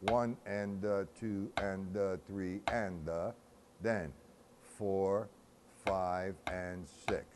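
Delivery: slow, evenly measured count; drawn-out rhythmic counting; breakdown count. Shown as a demonstration. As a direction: count out each beat slowly, in strict time. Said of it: "So we'll do measure number one".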